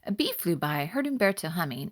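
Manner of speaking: ordinary delivery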